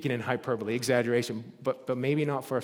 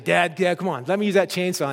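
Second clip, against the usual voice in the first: a bit higher and louder, rushed begging; whiny teen pleading; 5 semitones higher, 6 dB louder than usual. Retold or imitated. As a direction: pester dad for the chainsaw, quicker. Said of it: "And I was like".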